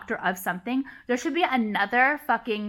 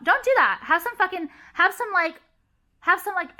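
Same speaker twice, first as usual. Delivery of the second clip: high scolding; 8 semitones higher, 4 dB louder than usual. Acted. Scolding imagined fake doctor.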